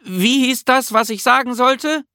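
high, held-up call down the stairs; shouted question. A narrator's reading. Bertil shouts down to Nisse for the forgotten word, shouting. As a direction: call down to someone below, high and urgent. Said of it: "schrie er zu Nisse hinunter".

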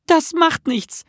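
high, bright-pitched assurance from a little creature; reassuring Nisse. A narrator's reading. Nisse assures Bertil it does not matter, reassuring. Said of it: "versicherte Nisse ihm".

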